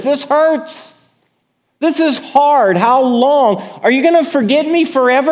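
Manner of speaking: high, loud, pleading cry to God; desperate plea. Acted. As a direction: beg God like someone abandoned and hurting. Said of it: "The, the writer of this psalm says".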